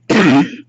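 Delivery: throat-clearing cough; real cough. Real, not acted. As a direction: clear the choke. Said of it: "give me a sec".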